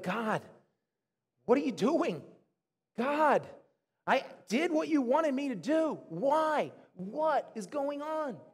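re-enacted exasperated plea, rising in pitch; angry with God. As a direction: plead upward at God, slowing on the last question. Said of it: "has anyone been angry with God".

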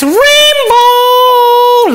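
high, slow wailing guess; drawn-out cry. Acted. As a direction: stretch it into a hammed-up sob.

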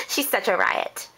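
normal talking voice

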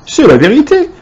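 high, emphatic exclamation; high-pitched cry without full volume. A narrator's reading. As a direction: insist it like excited old folk in chorus. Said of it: "crièrent les quatre vieux en chœur".

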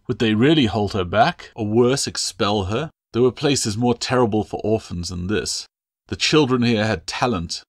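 usual speaking voice

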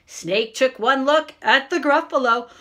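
plain reading voice

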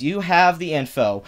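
ordinary talk, nothing marked

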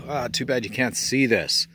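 ordinary talk, nothing marked

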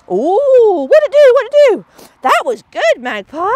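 excited cheering. Real, not acted. Trainer cheering Magpie at the beep, high and loud. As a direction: celebrate the dog's brave look.

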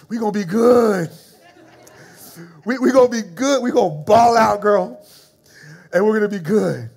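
loud, laughing celebration; joyful shout. Retold or imitated. Shouting joyful news to his wife on the phone, loud and laughing. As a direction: holler the good news.

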